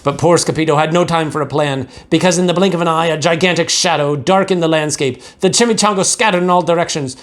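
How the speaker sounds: ordinary delivery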